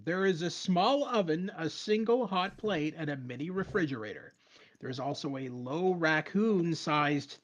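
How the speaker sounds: dramatic game narration. Acted. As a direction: read it out with measured, stretched phrases. Reads game text aloud in narrator style.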